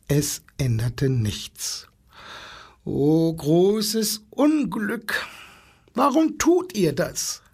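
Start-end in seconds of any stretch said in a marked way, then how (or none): 2.8-7.4 high, drawn-out lament; despairing. A narrator's reading. Little zookeeper pleads with animals turning their backs, despairing. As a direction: wail it in mild despair. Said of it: "fragte der kleine Zoowärter verzweifelt".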